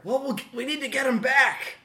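high, loud rallying cry; calling back urgently. Acted. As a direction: shout back to rally the group. Acted reply to the yelled alarm.

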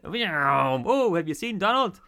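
high, hammed-up actor's call; overacting chef. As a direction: ham it up like an amateur actor. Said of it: "he's just overacting it, just hamming it up".

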